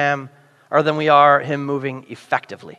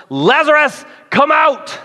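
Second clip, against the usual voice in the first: higher, calling Lazarus out of the tomb; loud call; 8 semitones higher, slower than usual. A narrator's reading. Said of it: "called out in a loud voice".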